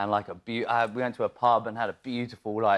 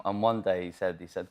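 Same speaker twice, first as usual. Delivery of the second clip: normal talking voice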